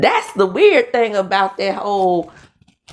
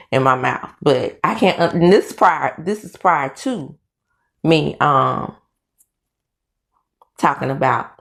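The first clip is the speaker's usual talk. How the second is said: ordinary talk, nothing marked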